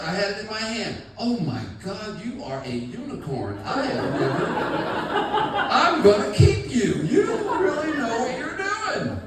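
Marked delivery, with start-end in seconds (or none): 1.2-9.2 higher, louder, delighted gushing; mock girlfriend adoring him; hammed-up swooning girlfriend.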